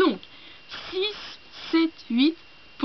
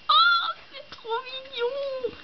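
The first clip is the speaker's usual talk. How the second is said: very high, drawn-out squeal at something cute; high-pitched gushing coo; delighted squeal; slower than usual. Real, not acted. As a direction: squeal it slowly, melting with delight. Squealing at cute cat bookmarks.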